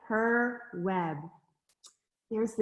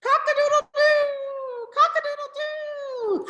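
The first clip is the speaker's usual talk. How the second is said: very high crow, long final doo; rooster crowing; 5 dB louder than usual. A narrator's reading. Reader voicing the rooster for a child, high crowing. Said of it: "crowed the rooster".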